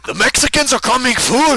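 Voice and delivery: high, loud Mexican-Scottish parody; Mexican-Scottish accent. Retold or imitated. Friend mocking the botched neighbor impression, Mexican-Scottish accent. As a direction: spoof a panicked cry in Mexican-Scottish accent. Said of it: "like, a Mexican Scottish".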